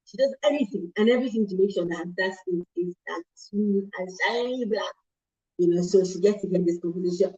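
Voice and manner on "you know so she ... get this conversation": ordinary talk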